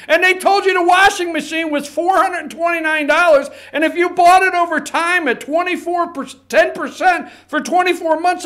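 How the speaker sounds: heated rant, pitched high; worked up. Real, not acted. Radio host fuming over how purchases get explained, worked up. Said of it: "I swear I wasn't gonna get worked up".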